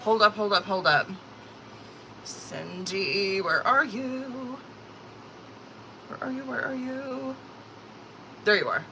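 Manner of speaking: soft, drawn-out murmured calling; absorbed searching. Real, not acted. Streamer searching for Cindy's message, softly drawn out. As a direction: softly stretch it while you hunt for someone.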